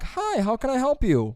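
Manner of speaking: lightly high canned greeter voice; automated greeting. Retold or imitated. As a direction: sound like the recorded drive-thru welcome. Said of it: "Taco Bell has an automatic".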